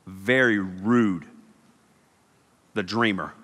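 low, slow, deadpan "Very rude."; dry aside. Real, not acted. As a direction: drop it low and slow, unimpressed.

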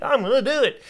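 high loud cocky cartoon voice. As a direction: blurt it out boldly. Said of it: "Cuphead's like".

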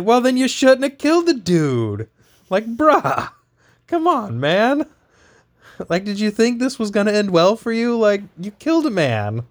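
high-pitched, loud bro scolding; exasperated high bro voice; high exasperated dude voice. Acted. God scolding Cain, high far voice.

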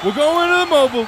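fast shouted call. Acted. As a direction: rattle it off loud. Repeated faster, louder.

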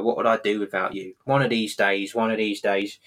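normal talking voice

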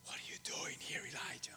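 soft unvoiced whisper of God; gentle whisper. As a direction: breathe it barely audible. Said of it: "A gentle whisper".

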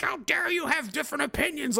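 high-pitched indignant imitation; mock outrage. Imagined outraged family member.

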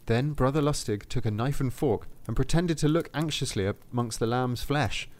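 ordinary delivery

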